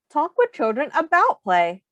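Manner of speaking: plain reading voice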